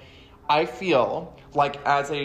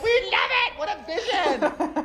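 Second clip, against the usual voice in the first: gushing fans. Retold or imitated. Mocking adoring fans, high laughing swooning. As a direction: swoon over the film. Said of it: "they're like".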